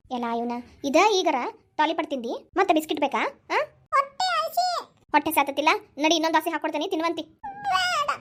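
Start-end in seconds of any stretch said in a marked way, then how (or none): 3.7-4.8 put-on very high, drawn-out little kid whine; plaintive high child voice, very slow; whining small child. 7.4-8.1 put-on very high, tearful little kid voice; sulky toddler cry; whiny child refusal. Child refuses food, crying.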